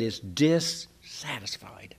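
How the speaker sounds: slow, voiceless whisper; whispered confession. Acted. Preacher confides to the church in a slow whisper. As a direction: let the word dissatisfied fall to a whisper.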